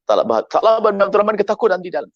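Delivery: normal talking voice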